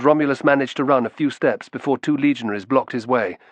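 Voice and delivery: usual speaking voice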